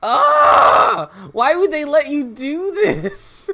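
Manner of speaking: high shout, then slow, drawn-out wail; shocked outcry. Real, not acted. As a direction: scream in disbelief and draw it out. Screams in shock at the game.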